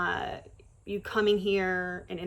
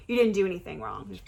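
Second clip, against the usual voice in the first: ordinary talk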